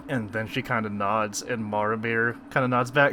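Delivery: normal talking voice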